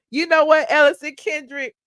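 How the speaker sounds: mock scolding call-out. Shown as a demonstration. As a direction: call the name out sharply. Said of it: "calls out viewer twice".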